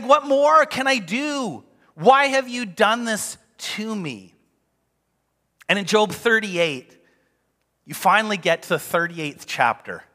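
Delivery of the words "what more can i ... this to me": high, slow retold outcry; acted exasperated plea to God; angry at God. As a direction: protest loudly, drawing the words out. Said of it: "he's angry with God".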